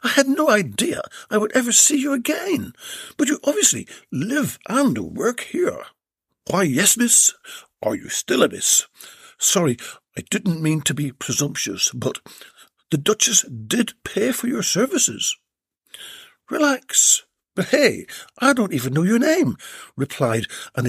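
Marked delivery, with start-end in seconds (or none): 0.0-5.9 higher lady's voice, delighted; a well-bred woman, pleasantly surprised; Belinda's voice. Acted. Belinda to Jed at his door, higher delighted voice. 6.5-15.4 lightly voiced tradesman, a bit bashful; a polite workman, apologetic; Jed's voice. Jed to Belinda at his door, higher bashful voice. 16.5-19.6 high, playful lady; a flirtatious society woman; Belinda's voice. Acted. Belinda teasing Jed about his name, higher playful.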